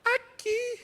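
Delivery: put-on very high little boy voice; crying child. Son showing where it hurts, very high. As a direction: squeak it like a hurt toddler. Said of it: "Aí diz".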